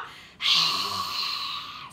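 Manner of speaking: long, forceful dragon exhale; big audible whooshing exhale; fire breath out. Shown as a demonstration. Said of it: "breathe it out".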